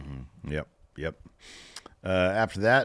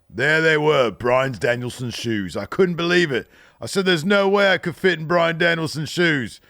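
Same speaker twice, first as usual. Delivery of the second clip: put-on higher, louder cheeky British accent; Will Ospreay impression; 5 semitones higher, 3 dB louder than usual. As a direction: land the joke like a cocky London lad. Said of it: "I love your Ospreay impression".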